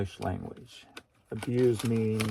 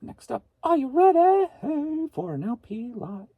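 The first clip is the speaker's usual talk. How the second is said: much higher and louder hype call; excited hype; 7 dB louder than usual. Real, not acted. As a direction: rev up the audience. Hyping viewers for next item.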